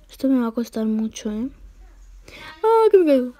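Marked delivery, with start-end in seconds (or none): 2.6-3.3 higher startled cry; screaming while falling. Real, not acted. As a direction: yelp as you fall. Falling in game.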